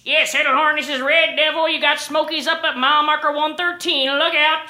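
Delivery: loud trucker on the radio. Acted. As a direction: call out the warning over the CB. CB radio reply between truckers.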